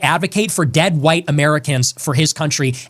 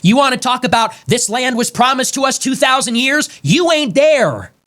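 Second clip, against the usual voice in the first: angry raised voice; 6 semitones higher than usual. Real, not acted. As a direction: shout them down with rising fury. Ranting warning at rivals.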